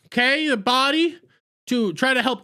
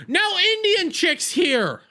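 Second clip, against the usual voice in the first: exasperated shout; 8 semitones higher, slower than usual. Real, not acted. Recapper announces new arrival, exasperated.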